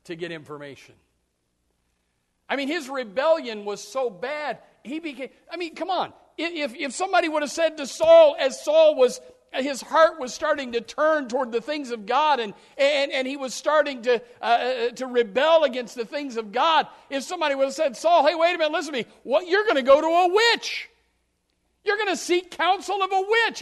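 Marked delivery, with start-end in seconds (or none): 2.5-18.0 loud, high, driving sermon delivery; preaching at full force. Acted. Preacher pressing the church hard, full-force preaching. 18.0-23.6 loud, high pleading warning; urgent warning. Acted. Someone warning Saul off the witch, loud and urgent.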